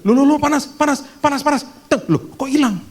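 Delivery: high, loud startled exclamations; startled wife. Retold or imitated. Wife feeling fever leave her husband, startled and high. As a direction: gasp it out as the heat hits your hands.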